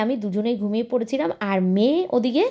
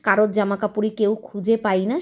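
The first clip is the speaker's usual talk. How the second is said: ordinary talk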